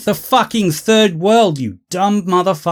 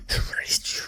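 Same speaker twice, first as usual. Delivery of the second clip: fading whisper; 13 dB quieter than usual. Acted. Repeated, fading to nothing.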